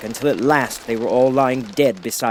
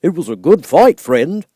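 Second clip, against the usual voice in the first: higher, louder friendly praise; proud scarecrow after a battle; cheerful scarecrow; 5 semitones higher, 7 dB louder than usual. Acted. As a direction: clap your friend on the back.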